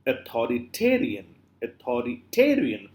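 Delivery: "Authoritarian" with ta lifted and lengthened; stress on ta. Shown as a demonstration. Teacher showing the stressed syllable, stress on ta. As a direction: make ta the peak of "Authoritarian".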